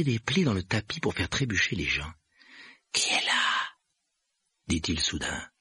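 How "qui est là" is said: eerie, whispery creature voice; spooky, unpitched poltergeist voice; poltergeist challenge. A narrator's reading. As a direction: call into the dark with rasping breath. Peeves suspects someone is there.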